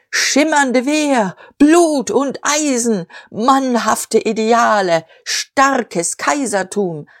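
high, slow pompous declaiming; bombastic ranting. A narrator's reading. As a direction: hammer out each slogan slowly. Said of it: "Kraftworte stießen rasselnd aneinander". (schwülstig und gedehnt deklamiert)

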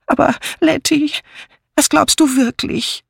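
high-pitched plaintive whine; wailing. A narrator's reading. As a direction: plead with her in a high wail. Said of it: "jammerte Miss Banner".

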